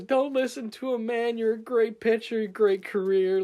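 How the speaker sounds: high, loud imitation of a supporter; shouted encouragement. Kid standing up to back the pitcher, imitated shouting. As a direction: yell mock reassurance across the stands. Re-enacts boy cheering the pitcher.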